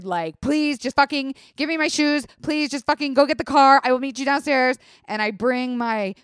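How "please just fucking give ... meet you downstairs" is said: firm, clipped-free orders, a bit higher and louder; strict and straight. Retold or imitated. Ordering boyfriend to get shoes and car, firm and strict. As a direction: lay down the law, no room for argument. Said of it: "being very strict and straight with Jared".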